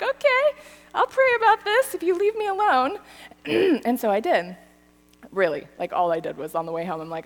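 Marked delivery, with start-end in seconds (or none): 0.0-3.0 reluctant giving in. Retold or imitated. Retold giving in to friend.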